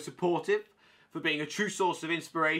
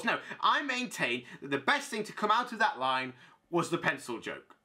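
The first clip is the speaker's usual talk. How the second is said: ordinary talk, nothing marked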